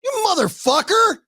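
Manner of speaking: shrill-free high mock rage at traffic; mock angry wife cursing. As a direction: play the wife swearing at cars she can't be heard by. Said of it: "you'll hear her go".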